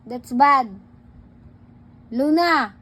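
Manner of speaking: ordinary delivery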